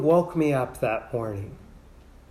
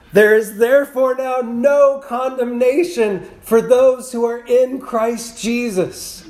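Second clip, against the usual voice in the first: loud, high proclamation, slowing at the end; yelled out; 7 semitones higher, 5 dB louder than usual. Retold or imitated. Friend reading scripture wakes a sleepy student in chapel, yelled out. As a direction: yell the verse out to jolt a dozing listener awake. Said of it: "then he laughs yells out".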